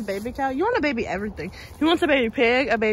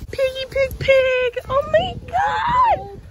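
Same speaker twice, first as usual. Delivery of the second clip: excited calling; 10 semitones higher, slower than usual. Real, not acted.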